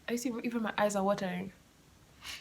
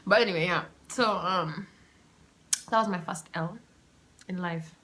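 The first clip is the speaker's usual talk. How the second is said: ordinary delivery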